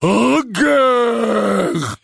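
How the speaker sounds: loud, high, long-held roar; giant's roar. Acted. Giant leaning down over the drawn bows, roaring. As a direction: roar it out like a huge creature. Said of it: "puis rugit".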